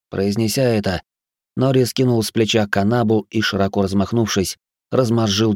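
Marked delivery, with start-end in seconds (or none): none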